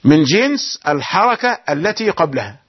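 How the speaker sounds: ordinary talk, nothing marked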